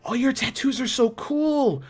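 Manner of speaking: put-on high, slow, starry-eyed child voice; awed kids. Orphan gushing over Eurydice's tattoos, high and awed.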